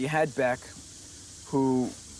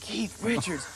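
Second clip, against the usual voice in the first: high-pitched mock astonishment; raised pitch, retold disbelief; imitated group amazement; 8 semitones higher, slower than usual. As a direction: echo the name as an awed crowd. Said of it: "And everybody went".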